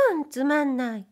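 wife pouting playfully at her husband's ghost. A narrator's reading. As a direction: coax him like a bored wife. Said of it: "まだふざけ足りないと見え".

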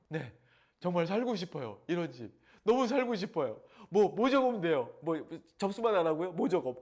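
loud, high, gushing eagerness; breathless excited signing up; eager excitement. Retold or imitated. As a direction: gush like a dazzled buyer. Excitedly signing up at model house.

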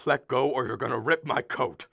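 ordinary delivery

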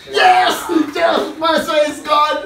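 high, loud victory cries; jubilant shouting. Real, not acted. Player celebrating Barca going out, jubilant shouting. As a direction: shout it out in wild triumph.

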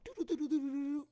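high sung dialing beeps; phone dial tones. Retold or imitated. Comic imitating grandma dialing the store. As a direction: mimic the dial tone.

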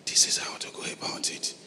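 soft unvoiced whisper. Shown as a demonstration. Holy Spirit speaking inside you, whispered. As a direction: breathe it like a secret voice within. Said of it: "will whisper those things to you".

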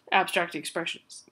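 speaking through hiccups; hiccupy, halting delivery. Real, not acted. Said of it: "I'm having the hiccups".

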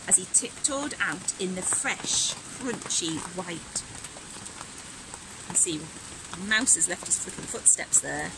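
put-on bright, animated read-aloud for kids; lively storybook reading. A narrator's reading. Teacher reading Robin's winter story aloud, animated and bright. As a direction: act the story out with energy.

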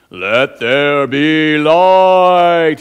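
booming slow voice of God, loud and drawn out; big deep-voiced God. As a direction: boom it out like a movie God. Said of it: "but he talks like this".

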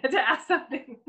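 amused, half-laughing teasing. Real, not acted. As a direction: laugh through the words. Laughing at shy audience.

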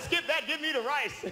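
high-pitched exasperated comic reply; fed-up mock order. Fed-up customer ordering rice from waiter, high mock exasperation.

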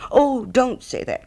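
high, slow pleading protest; little Hans protesting earnestly, pitched well up; distressed cry. Acted. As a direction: cry out as if stung by the reproach. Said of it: "cried little Hans".

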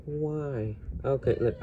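normal talking voice

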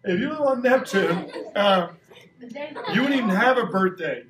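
talking through real laughter; can't keep a straight face, laughing through it. Real, not acted. Teacher laughing with the class.

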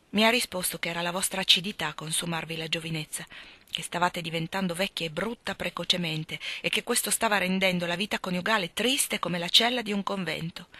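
ordinary delivery, nothing marked

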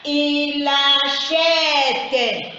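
very slow, chanted "Il achète"; drawn-out teacher's model reading of "achète"; syllable by syllable. Shown as a demonstration. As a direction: hold each syllable of "Il achète" on a level tone. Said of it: "On lit les tout".

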